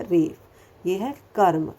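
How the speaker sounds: normal talking voice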